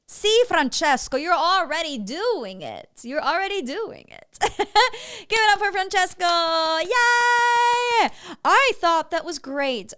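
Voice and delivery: loud, high praise with laughter; real delighted cheering; excited cheering. Real, not acted. Cheering the student's performance.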